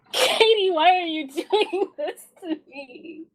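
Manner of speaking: half-laughing plea through giggles. Real, not acted. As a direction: laugh while protesting to the GM. Player laughing at sad scene.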